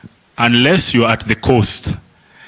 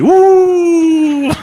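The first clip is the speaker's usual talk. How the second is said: a sudden much higher, louder whoop; mock cheer; 5 dB louder than usual. Teacher mimics people jeering at a slip, mock whoop.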